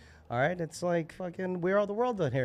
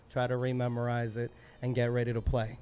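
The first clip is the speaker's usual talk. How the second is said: ordinary delivery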